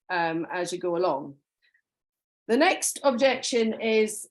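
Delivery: normal talking voice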